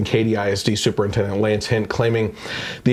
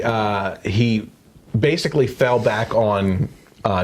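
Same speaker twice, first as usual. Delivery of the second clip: ordinary talk, nothing marked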